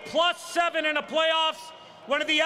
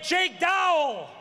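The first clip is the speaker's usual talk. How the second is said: announcer call; 2 semitones higher, slower than usual. Acted. Arena announcer calling player name.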